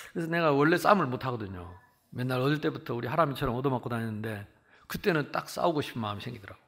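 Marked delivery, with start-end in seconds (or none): none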